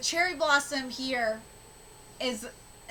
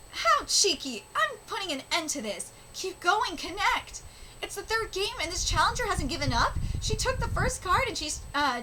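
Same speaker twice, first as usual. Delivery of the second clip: higher, earnest reading voice for the characters; reading manga aloud; 6 semitones higher than usual. Acted. Vlogger reading karuta manga dialogue to viewers, earnest and higher. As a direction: read each speech bubble with feeling.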